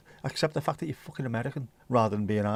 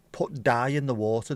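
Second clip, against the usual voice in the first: usual speaking voice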